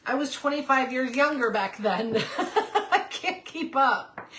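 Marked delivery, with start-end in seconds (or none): 2.3-4.3 words coming through a laugh, higher and slow; real helpless chuckle. Real, not acted. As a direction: laugh at yourself as you say it. Laughs at own tiredness.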